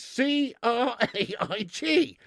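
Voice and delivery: slow, letter by letter; spelling out. Shown as a demonstration. Streamer proving how Craig is said, spelling slowly. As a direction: spell it out pointedly, one letter at a time. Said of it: "the spelling of the name".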